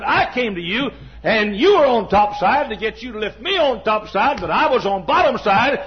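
high, driving pulpit delivery; impassioned preaching. Acted. Preacher voicing Paul's appeal to the church, impassioned.

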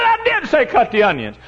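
high, loud exasperated reply; exasperated insistence. Retold or imitated. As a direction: push back hard at the waitress. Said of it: "I said".

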